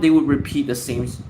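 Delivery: normal talking voice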